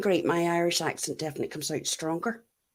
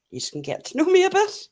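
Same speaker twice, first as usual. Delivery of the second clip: normal talking voice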